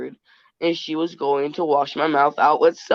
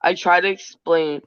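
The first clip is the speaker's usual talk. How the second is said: ordinary talk, nothing marked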